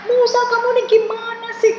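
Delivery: frightened Israelites scolding Musa, high and angry; angry complaint. Retold or imitated. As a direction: hurl the blame at Musa. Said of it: "mereka marah sama Musa".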